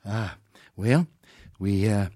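halting, stumbling president impression; lightly voiced Reagan fumbling for an answer; Ronald Reagan. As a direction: stall between words, at a loss. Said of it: "he keeps going".

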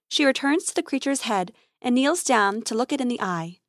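usual speaking voice

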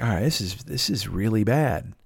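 low, soft, slowing inner voice; subdued concern. Retold or imitated. Thinking in his head at the parking lot, subdued concern. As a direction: think it to yourself, dropped and soft.

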